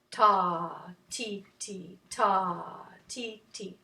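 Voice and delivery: unhurried, low rhythm syllables; slow tempo. Shown as a demonstration. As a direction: spread the beats wide apart. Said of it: "at a slow tempo".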